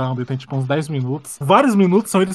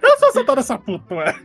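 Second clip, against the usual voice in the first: laughing; 8 semitones higher, 3 dB louder than usual. Real, not acted. Repeating quote while laughing.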